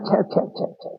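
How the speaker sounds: whispered shocked "chha" clicks of shame; ashamed tut-tutting. Acted. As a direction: hiss a shamed "chha, chha" behind the hand. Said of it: "লজ্জায় তাড়াতাড়ি মুখে হাত চাপা দিয়ে ছ্যা".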